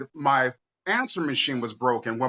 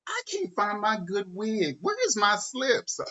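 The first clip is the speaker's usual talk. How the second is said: mom's voice; 7 semitones higher than usual. Retold or imitated. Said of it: "My mom would come out and say".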